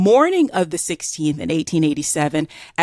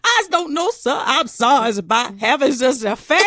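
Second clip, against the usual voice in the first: much higher, contrite mock Southern accent; mock apologetic Southern; 5 semitones higher than usual. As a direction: beg pardon in a hammed-up Southern accent.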